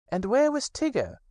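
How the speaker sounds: high, pleased rabbit voice; high self-satisfied Rabbit. Acted. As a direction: Rabbit taking credit, high. Rabbit asks.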